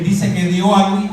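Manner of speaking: ordinary talk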